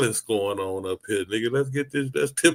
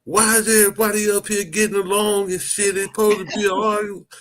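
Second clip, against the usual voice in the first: higher mock Savio complaint, the last words rushed fast; hammed-up grumbling agitator; imitating Savio; 8 semitones higher than usual. Retelling Savio's complaint to friends, mock whine. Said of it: "That motherfucker Savio said".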